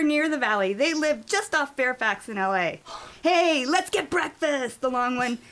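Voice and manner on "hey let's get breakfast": higher, slow, hyper-dude suggestion; bouncy eagerness. A narrator's reading. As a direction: propose it like an eager kid bouncing. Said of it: "said, bouncing in a seat".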